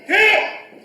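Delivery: high, explosive martial arts shout; loud kiai yell. Real, not acted. Karate class yelling on each punch, loud kiai. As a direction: yell it hard as you punch. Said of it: "Yell louder".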